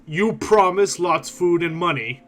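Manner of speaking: slow, suspicious ogre. Acted. Ogre glaring at the player, slow and suspicious. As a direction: glare and weigh every word. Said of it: "glare down at you and says".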